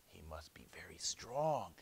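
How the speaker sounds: low, soft inner voice; hushed, wary dragon; dragon thinking to himself. Acted. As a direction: think it aloud quietly, a little cowed. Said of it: "thought the real dragon".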